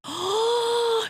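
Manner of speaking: big high gasp of shock; dramatic gasp. Real, not acted. Host hearing the girlfriend cried, high shocked gasp. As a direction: gasp as if horrified.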